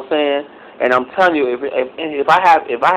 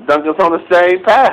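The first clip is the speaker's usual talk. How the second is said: usual speaking voice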